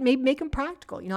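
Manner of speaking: usual speaking voice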